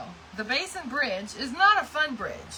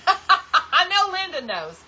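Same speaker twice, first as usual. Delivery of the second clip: slow, louder, higher, laughing through it; 7 semitones higher, 7 dB louder, slower than usual. Real, not acted. Teasing viewer Linda about the bridge, laughing. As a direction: tease her through a laugh.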